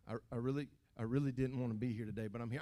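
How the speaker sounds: low, reluctant half-hearted churchgoer. Acted. Reluctant churchgoer arriving at service, half-hearted. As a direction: drag it out low, unwilling to be here. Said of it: "Don't come in here going".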